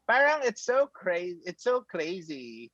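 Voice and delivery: put-on "Parang" pitched high and stretched, Filipino accent; hammed-up Filipino accent, high and slow; mock Filipino accent. Teasing how Filipinos say words, high slow Filipino accent. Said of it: "but then they say crazy".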